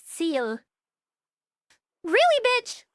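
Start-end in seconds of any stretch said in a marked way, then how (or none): 2.0-2.8 higher, louder burst of disbelief; indignant outburst. Real, not acted. Streamer reacting to an interruption, indignant outburst. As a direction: challenge them, pitched up and loud. Sudden reaction to something going wrong.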